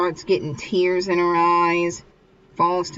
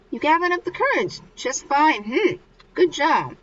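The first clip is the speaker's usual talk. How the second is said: high, brisk congratulation; acted praise; 9 semitones higher than usual. Mikio congratulates Miko on her courage, acted praise.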